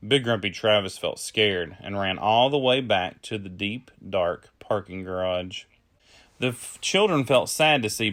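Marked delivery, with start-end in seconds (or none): none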